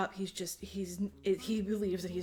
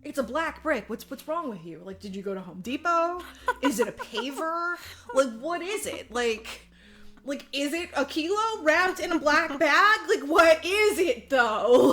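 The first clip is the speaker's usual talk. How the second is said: starts higher, builds louder and slower to a laugh; higher incredulous teasing that grows louder; mocking rant; 6 semitones higher, 9 dB louder than usual. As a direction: ridicule them, building up steam. Mocking rant at brick owners.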